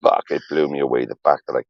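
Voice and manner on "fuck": stunned whisper. Retold or imitated. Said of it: "I was like".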